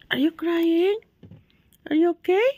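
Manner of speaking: sing-song rise at the end; cooing question. Real, not acted. Checking on the dreaming sleeper.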